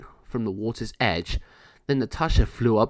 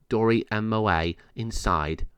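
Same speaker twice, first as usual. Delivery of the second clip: ordinary delivery, nothing marked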